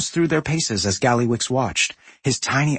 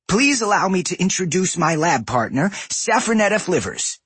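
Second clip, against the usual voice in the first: formal goblin man; 7 semitones higher than usual. A narrator's reading. Voices Grizzik formally.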